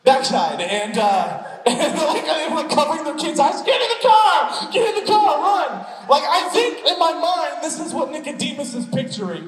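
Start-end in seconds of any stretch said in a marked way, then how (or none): 3.6-5.9 high, loud panicked yell; panicked shout. Retold or imitated. Parents ordering their kids into the car, shouting in panic.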